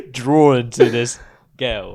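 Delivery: loud, slow soap-opera narration; milk the drama slowly; dramatic narration. Acted.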